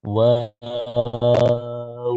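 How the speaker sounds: ওয়াও held long and very slow; stretched waw. Shown as a demonstration. Teacher showing kids how long to hold ওয়াও. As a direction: draw ওয়াও out for a full count. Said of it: "ঠোঁট গোল করে উচ্চারণ করতে হবে".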